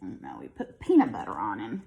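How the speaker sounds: ordinary delivery, nothing marked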